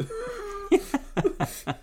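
high-pitched burst of laughter; real laughter at the scene. Real, not acted.

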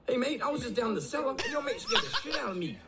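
high, soft mock-American worker voice; terrible American accent. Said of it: "that is the worst American accent I've ever heard".

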